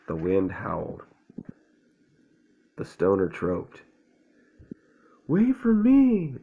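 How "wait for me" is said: high, loud, drawn-out call; shouted. A narrator's reading. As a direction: yell after the others in a high voice. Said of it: "shouted Owl".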